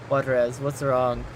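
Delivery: ordinary delivery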